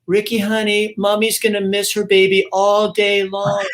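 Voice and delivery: put-on high, cooing baby talk of a mother; doting mom. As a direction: coo it like a mom to her little boy. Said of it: "she goes".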